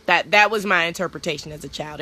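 ordinary talk

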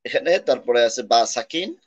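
ordinary delivery

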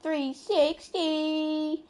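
very slow, stretched hype call; drawn out. Real, not acted. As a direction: stretch the words in awe. Hyping a trick shot.

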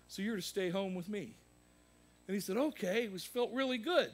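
high, loud sermon story; loud storytelling preach. Acted. Pastor telling the congregation a story, preaching loud. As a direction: tell it big from the pulpit.

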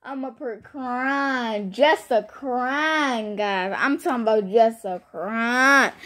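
CRYING stretched slowly, over and over; drawn-out emphasis. Real, not acted. Stressing to viewers how hard she cried, drawn-out. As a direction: dwell long on each "crying".

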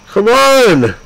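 high, fed-up shout; exasperated shout. Real, not acted. Player begging Nemo to show up, fed-up shout. Urging absent game character.

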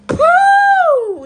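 very high, loud celebratory woo; joyful whoop. Real, not acted. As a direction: let out a joyous shout. Cheers after being overcome with emotion.

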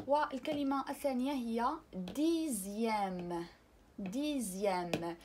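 ديزيام said very slowly with a buzzing z; French ديزيام, slow voiced z; slow z sound. Shown as a demonstration. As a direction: stretch ديزيام as "dizjam". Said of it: "الحرف إكس كيعطينا الصوت ز".

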